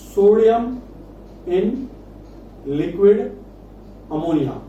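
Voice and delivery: word-by-word dictation as if writing along; slow dictation. Real, not acted. Teacher writes out the reaction for students, slow spaced-out dictation. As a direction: separate each word with a long pause.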